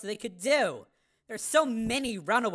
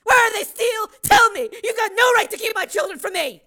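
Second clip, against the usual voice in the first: raised, higher frantic demand; a mother demanding her children from Steele, frantic and raised; desperate demand; 13 semitones higher, 11 dB louder than usual. Acted.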